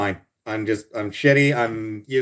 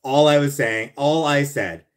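defensive insistence; 5 semitones higher, 6 dB louder than usual. Pretend.

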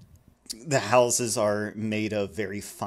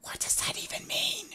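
put-on whispered child question; hushed, baffled little girl; 5 dB quieter than usual. Little girl whispering her confusion to the author.

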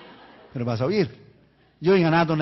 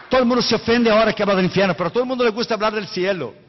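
fast, loud rush of words; rapid preaching; 4 semitones higher, 5 dB louder, faster than usual. Acted. Preacher pressing the congregation, fast and loud. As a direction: rattle it off fast.

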